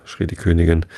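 usual speaking voice

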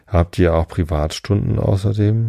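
ordinary delivery

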